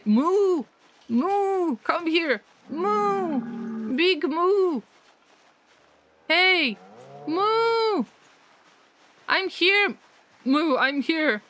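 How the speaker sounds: high playful moo calls. Pretend. Streamer luring cows in the game, high mooing calls. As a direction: call the cows with moos.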